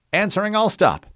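high, crisp confirmation; helmsman reply. Acted. Helmsman confirming the all stop, high and crisp. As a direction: call it back sharply.

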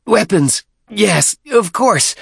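high, loud, drawn-out cry; shouted. A narrator's reading. Deacon blurting out agreement to the chieftain, shouted. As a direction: shout it out high, stretching the words. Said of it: "the deacon shouted".

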